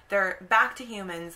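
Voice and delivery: usual speaking voice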